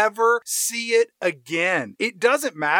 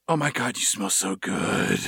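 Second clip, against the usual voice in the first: much lower, breathy swoon; mock swoon; 11 semitones lower, 3 dB quieter than usual. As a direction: say it like she's sniffing him. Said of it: "and goes".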